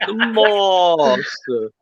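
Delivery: "The master" very slow with a long, plummy A; milk "master" like an aristocrat; exaggerated posh. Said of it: "some people exaggerate their words".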